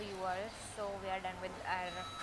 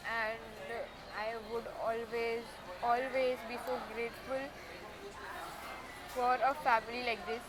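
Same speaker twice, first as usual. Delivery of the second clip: real emotion tugging at the voice, holding it together; thanking her family, close to tears; emotional. Real, not acted. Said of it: "I'm very emotional".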